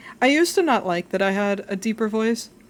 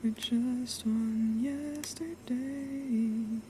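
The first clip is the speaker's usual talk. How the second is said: slow breathy big-sister tease; 3 semitones higher, 10 dB quieter, much slower than usual. Pretend. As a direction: purr it slowly like a cool older sister. Trying out deeper One-sama voice.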